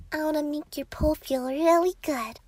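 ordinary delivery

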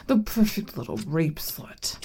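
whispered taunt close to the ear. Acted. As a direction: lean in and whisper.

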